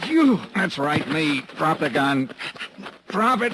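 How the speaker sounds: loud, high barking commands; furious shout. Acted. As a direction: yell him down at gunpoint. Johnny jumps Kraus at gunpoint.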